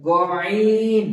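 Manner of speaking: গয়ন said loud from the upper throat; teacher modelling গয়ন; loud throaty demo. Shown as a demonstration. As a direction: push গয়ন out firmly from the top of the throat. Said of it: "ঠিক এখানে দেখুন".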